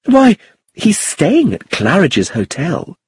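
high, slow indignant emphasis; angry. A narrator's reading. Iris defending where Tony stays, angry. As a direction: protest it high, stretching the words. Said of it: "she cried angrily".

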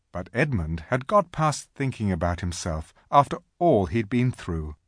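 ordinary delivery

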